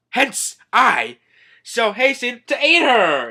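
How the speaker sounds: loud, high mock-heroic announcement; gallant declaration. Skeleton runs off to aid the princess, mock gallant. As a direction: proclaim it like a comic knight.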